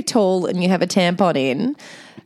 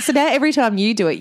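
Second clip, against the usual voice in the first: usual speaking voice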